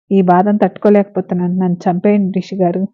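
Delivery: ordinary delivery, nothing marked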